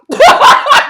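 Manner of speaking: high, loud laughing burst; burst of laughter. Real, not acted. Vlogger cracking up at Kim getting fired, loud high laugh.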